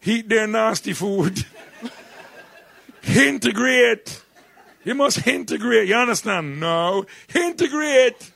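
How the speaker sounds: high, loud Jamaican mother scolding; Jamaican mum. Retold or imitated. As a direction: drag out Integrate like a stern Jamaican mum, slow and high. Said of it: "my mum told us to integrate".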